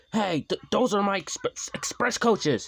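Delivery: high, loud stammering protest; cross spluttering. Acted. Gordon protesting his coaches are taken, cross splutter. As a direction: splutter it out, offended. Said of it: "he spluttered crossly".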